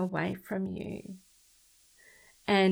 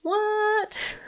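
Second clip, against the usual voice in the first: sudden squeaky high disbelieving what; a listener she imagines, reacting in shocked disbelief; incredulous what; 5 dB louder than usual. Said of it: "I know that that is just like".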